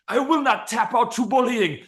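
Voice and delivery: forced out while the arm is being bent; strained fighter in an arm bar. Pretend. Fighter in an arm bar, vowing in a high strained voice. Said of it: "he's like".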